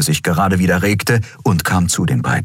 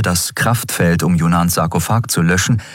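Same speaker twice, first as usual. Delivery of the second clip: ordinary delivery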